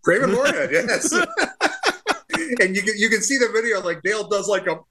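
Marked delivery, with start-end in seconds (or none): 0.0-3.5 higher, giggling through the name; laughing. Real, not acted. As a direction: repeat the punchline while cracking up. Repeating the punchline, cracking up.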